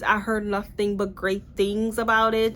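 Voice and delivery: normal talking voice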